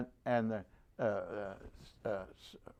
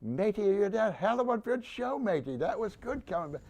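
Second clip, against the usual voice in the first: higher-pitched mock shout of praise; retold yell; 9 semitones higher than usual. Gunny calling praise to the returning jeep crew, a higher mild mock yell. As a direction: call it out like a proud gunny, not full volume. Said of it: "came out and yelled".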